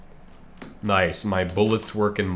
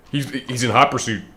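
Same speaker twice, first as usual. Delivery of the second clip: ordinary talk, nothing marked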